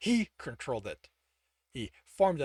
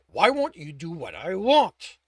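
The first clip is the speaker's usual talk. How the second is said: louder, strained, pleading with the metal; frustrated outburst; 4 semitones higher, 10 dB louder than usual. A narrator's reading. Tibbs failing to form a sword, frustrated outburst.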